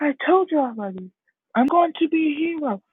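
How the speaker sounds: put-on high, protesting boy voice; retold child arguing back; upset insistence. Crying Deku to mom after telling her already, upset.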